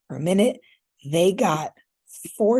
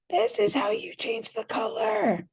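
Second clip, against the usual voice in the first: very high squealed imitation of exasperated viewers; mock screaming; 14 semitones higher than usual. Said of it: "is, like, screaming".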